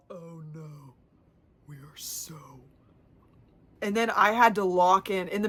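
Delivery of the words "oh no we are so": far softer, lower groaning; much softer queasy mumble; queasy dread. Real, not acted. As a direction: feel the stomach drop. Sudden nausea mid-loop.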